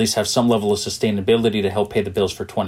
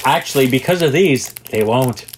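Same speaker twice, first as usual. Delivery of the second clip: laughing; 3 semitones higher, 4 dB louder than usual. Real, not acted. Joking through laughter.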